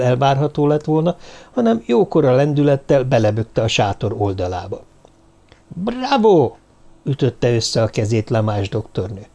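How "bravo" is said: high-pitched cheer; exclaiming. A narrator's reading. Doctor claps at the torn tent, exclaiming. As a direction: cry bravo with a big upward leap.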